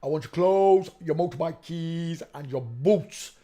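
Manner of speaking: loud, hammed-up Terminator line; Terminator impression. Vlogger mocks a photo by quoting the Terminator, loud hammed-up. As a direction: boom it out like the Terminator demanding his gear. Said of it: "look at the Terminator neck".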